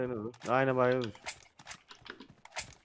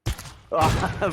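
gleeful laugh; 4 semitones higher, 5 dB louder than usual. Real, not acted. Laughs after shot.

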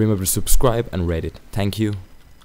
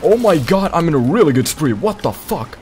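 high, loud, thrilled; excited shout; 8 semitones higher, 5 dB louder than usual. Real, not acted. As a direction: cheer about the streak. Kill streak excitement.

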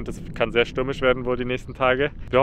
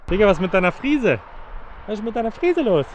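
teasing the horse; 9 semitones higher than usual. Real, not acted. Vlogger to a shaggy Icelandic horse, teasing it. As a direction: tease the horse about its mane.

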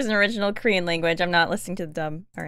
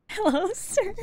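high, giggly call; laughing; 12 semitones higher than usual. Real, not acted. Viewer calls to the man on screen, laughing. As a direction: call out through rising giggles. Giggles while calling to character.

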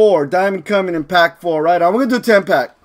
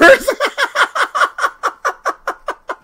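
high, softer trailing cackle; high laughter; 4 dB quieter than usual. Real, not acted. Streamer imagining fake YouTuber subscribers, laughing. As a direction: crack up at your own idea.

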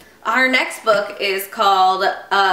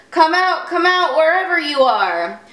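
mock bellow; 8 semitones higher than usual. A narrator's reading. Bo Peep imitates the wolf in the field, mock bellow. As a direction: call it out high like a storybook wolf. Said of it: "and he bellowed".

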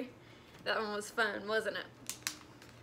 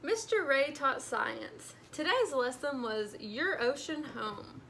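plain reading voice

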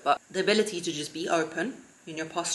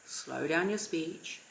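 much slower, softer delivery; modelling unhurried calm speech; 6 dB quieter, much slower than usual. Shown as a demonstration. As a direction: let each word of slow down your speech sink in.

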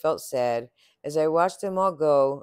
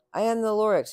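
coughing Lorax; 7 semitones higher, faster than usual. A narrator's reading. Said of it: "he coughed and he whiffled".

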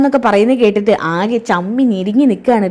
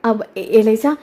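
nervous stammer; slower than usual. Retold or imitated. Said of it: "ശ്രുതി ആകെ tension ആവുകയാണ്".

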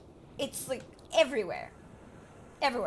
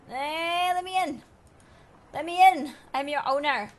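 demanding yells; 6 semitones higher, 8 dB louder than usual. Real, not acted. Locked out, demanding.

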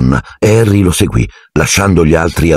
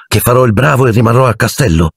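resigned; 7 semitones higher than usual. A narrator's reading. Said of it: "Chiese Harry rassegnato".

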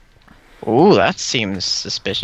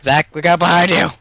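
warning shout; 6 semitones higher, 6 dB louder, faster than usual. Real, not acted. Warning a teammate.

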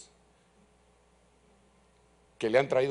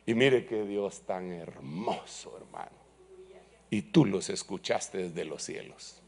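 tender worship; 5 semitones lower, slower than usual. Acted. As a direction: hush into awe of God. Tender worship after climax.

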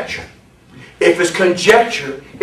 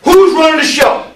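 high, raised preacher's voice; forceful preaching; 11 semitones higher, 5 dB louder than usual. Real, not acted. Preacher stops the reader to expound the verse, forceful preaching. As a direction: interrupt and drive the verse home loudly.

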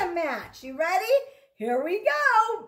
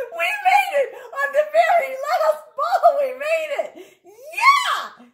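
loud, high, laughing cheer; joyful laughing shout; 8 semitones higher, 6 dB louder than usual. Real, not acted. Teacher cheering with the children, laughing. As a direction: celebrate with the class, laughing. Celebrating the final match.

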